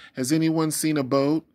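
high, loud pleading; father crying out to strangers for his lost son; desperate cry. Acted. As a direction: beg passers-by for help. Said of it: "he cried".

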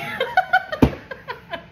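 high burst of laughter. Real, not acted. Reader laughs at her California jab. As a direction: crack up at the joke.

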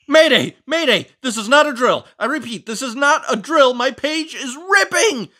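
loud, high emergency shouting; alarmed mayday call. Acted. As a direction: sound the alarm over a torn page.

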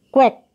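Quack, shouted high like a duck; loud duck quack. Shown as a demonstration. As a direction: call out Quack like a duck to the class. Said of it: "How does a lion make a sound?".

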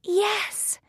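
very high little heroine voice; Thumbelina. A narrator's reading. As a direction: accept in a high, small voice. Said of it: "says Thumbelina".

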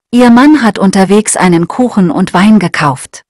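ordinary talk

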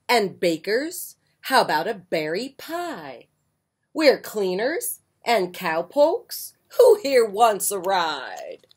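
lively picture-book read-aloud; bright storytime reading; reading to children. A narrator's reading.

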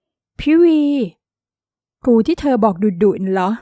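too-loud startled outburst; loud blurt. A narrator's reading. Girl blurting to her friend about the strict tutor, loud. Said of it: "คราวนี้กิ่งแก้วว่าเสียงดัง".

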